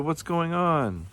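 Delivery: high, drawn-out storybook shout. A narrator's reading. Kangaroo demands to know what's happening, shouted. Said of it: "he shouted".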